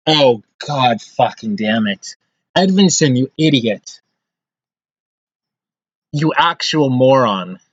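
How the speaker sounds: slow, bitter insults; angry cursing. Real, not acted. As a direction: curse out the blunder. Cursing a player.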